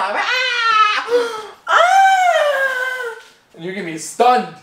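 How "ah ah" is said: high mock scream. Acting out a scared attack.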